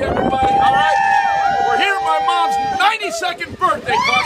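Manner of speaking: loud calling out. Real, not acted. Rallying crowd before the soaking.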